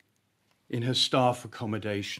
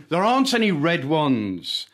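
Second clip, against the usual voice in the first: high, loud yell, close to tears; tearful yell; 8 semitones higher, 12 dB louder than usual. Acted. Boy yelling at the girl through tears. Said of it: "I yell through tears".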